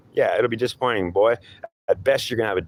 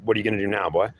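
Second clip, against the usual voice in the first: ordinary talk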